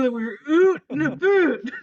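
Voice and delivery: a hammy fake Scottish accent, lightly done; mock Scottish with oot and a boot vowels; teasing Rose's attempt at a Scottish accent; bad Scottish accent. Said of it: "attempting her best Scottish accent".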